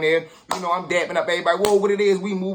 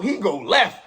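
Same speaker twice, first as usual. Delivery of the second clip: usual speaking voice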